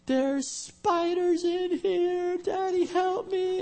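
very high, slow, stretched-out mock shriek; hammed-up falsetto cry, every word drawn out; child's terrified wail. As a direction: imitate a panicking little boy begging for rescue.

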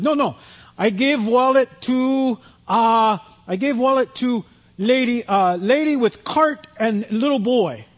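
put-on high, slow, halting Eastern European accent. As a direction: imitate an Eastern European man groping for English words. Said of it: "real heavy kinda Eastern European accent".

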